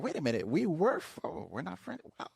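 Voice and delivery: dropping to a near whisper on discovering it; soft, stunned aside, as if just noticing; hushed realization. Pretend. Discovering an unfriending on Facebook.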